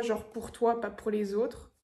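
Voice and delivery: ordinary delivery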